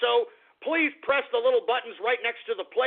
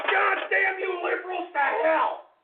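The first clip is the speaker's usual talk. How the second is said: furious ranting; 8 semitones higher than usual. Real, not acted. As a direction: pour out the rage, pitched way up. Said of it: "can you feel the anger".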